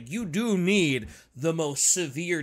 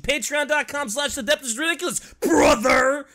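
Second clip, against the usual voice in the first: loud hyped plug, brother shout; wrestling promo; 5 semitones higher, 5 dB louder than usual. Acted. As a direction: sell it like a ring announcer.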